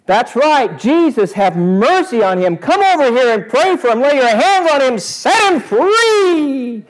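high, loud cheering crowd voice; hyped-up crowd. Acted. Crowd urging Jesus to heal the beggar, loud and high. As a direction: ham up a crowd egging on the healer. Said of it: "And the crowd says".

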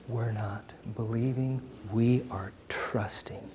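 soft, slow whisper. Acted. Pastor quietly assuring the congregation, whispered. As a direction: breathe it out like a secret.

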